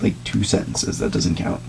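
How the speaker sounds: breathy near-whisper; a whispered protest; whispered aside. Real, not acted. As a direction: mutter it under your breath. Arguing about paragraph count.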